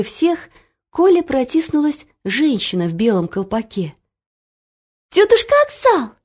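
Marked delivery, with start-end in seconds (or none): 5.1-6.1 high, loud joyful cry; excited calling out. A narrator's reading. Girl spotting her auntie in the crowd, excited cry. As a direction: call out to someone dearly missed. Child calls out to beloved aunt.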